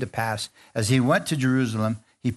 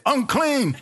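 high mock yell; playful mock yell; 12 semitones higher than usual. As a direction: shout it out as a warning, pitched high. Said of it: "start yelling at us, say".

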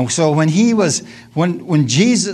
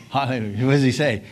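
ordinary talk, nothing marked

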